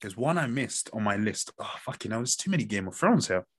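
normal talking voice

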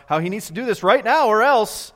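loud, high, heated telling; angry yelling retold. Preacher retells Nee's demand to the swimmer, heated yell. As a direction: push it up into a yell.

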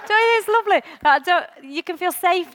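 real giggle breaking through the question; laughing. Real, not acted. Preacher laughing with the congregation about being weird. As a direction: laugh it out, high and quick at the end. Laughing at her own confession.

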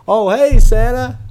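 bright high hello; high surprised greeting. Acted. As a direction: pitch up for a cheerful hi. Greeting Santa in own role.

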